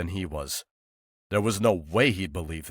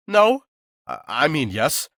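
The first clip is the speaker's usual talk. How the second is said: flustered; 8 semitones higher, 9 dB louder than usual. Acted. Flustered confession of throwing knives.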